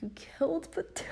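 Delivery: breathless whispered disbelief; to the driver who wrecked her car, a shocked whisper; stunned whisper. Retold or imitated. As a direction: hush it like you can't believe it. Stunned question to the other driver.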